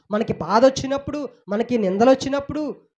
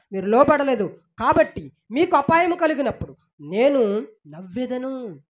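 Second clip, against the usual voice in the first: ordinary delivery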